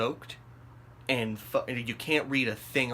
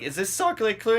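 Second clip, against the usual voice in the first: high, loud, urgent asking; anxious question; 9 semitones higher, 5 dB louder than usual. Retold or imitated. Lost tourist asking a stranger, anxious question. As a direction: act out an eager, keyed-up question.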